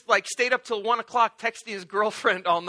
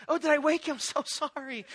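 mock apology; 6 semitones higher than usual. Fake apology to sleeping Jesus.

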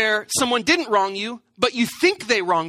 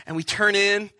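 usual speaking voice

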